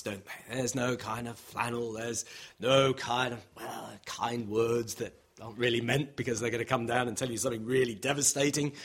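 ordinary talk, nothing marked

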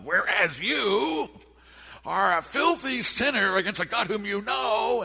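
loud, high, slow preacher's rebuke; thundering rebuke. Retold or imitated. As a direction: denounce them, slow and loud. Quoting the preacher's rebuke again.

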